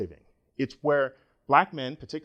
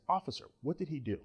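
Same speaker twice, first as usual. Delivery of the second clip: much softer, mild polite question; polite concerned query; 9 dB quieter, faster than usual. Retold or imitated. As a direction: ask gently, sure you'll be heard.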